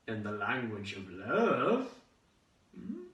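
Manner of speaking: slow, lilting would-be seducer; suave flirtation.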